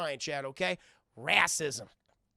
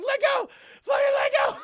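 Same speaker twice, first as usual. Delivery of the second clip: very high, loud shriek; hammed-up yell to release; mock panicked scream; 12 dB louder than usual. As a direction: screech it in comic desperation. Voices Jackie yelling to let go.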